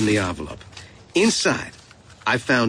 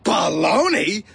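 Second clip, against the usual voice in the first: higher, drawn-out cry of protest; defiant outburst; 6 semitones higher, slower than usual. A narrator's reading. Boy banging the table at Ma, defiant. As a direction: throw it back defiantly. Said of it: "I banged the table".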